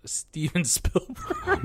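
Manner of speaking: words breaking into real laughter; laughing guesses. Real, not acted. Co-hosts joking over which director to name, laughing. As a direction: toss out names through a laugh.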